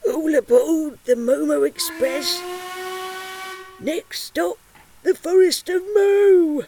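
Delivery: high loud all aboard call; train conductor calling. Acted. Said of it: "shouted out".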